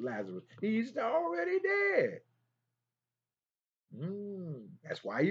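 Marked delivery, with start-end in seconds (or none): 0.6-2.2 preached emphasis. Acted. Preacher stresses Lazarus's death.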